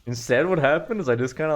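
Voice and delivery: ordinary talk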